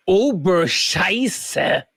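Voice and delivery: very slow, drawn-out mock German curse; German mode. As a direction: stretch it out in a hammed-up German accent. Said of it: "got into my German for a second".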